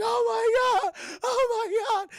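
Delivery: very high, loud, drawn-out wail; hammed-up tearful terror; acted panic. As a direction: wail it like a fleeing movie heroine. Acting Sarah running away in panic.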